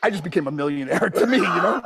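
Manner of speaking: usual speaking voice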